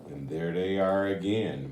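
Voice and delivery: usual speaking voice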